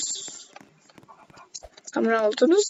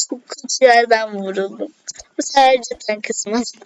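normal talking voice

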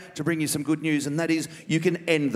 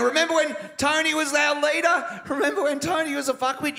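mock bitter heckle; 9 semitones higher than usual.

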